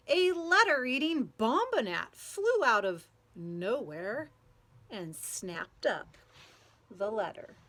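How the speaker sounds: lively storybook read-aloud with playful sound words; reading to children. A narrator's reading. Teacher reading a picture book to children, lively and playful. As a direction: read it out to a group of kids, bouncing along.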